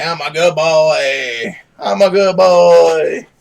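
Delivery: slow, drawn-out doggy talk; slow pet-voice prompt; speaking for the dog. Pretend. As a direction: coax the dog to copy, stretching every word.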